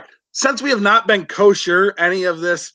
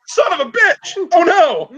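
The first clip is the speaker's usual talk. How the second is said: much higher, louder panicked gasp; realizing he may have offended the host, panicking; mock panic; 11 semitones higher, 4 dB louder than usual. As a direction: play the sudden dread of having offended. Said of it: "Oh, I was like".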